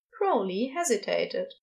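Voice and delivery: usual speaking voice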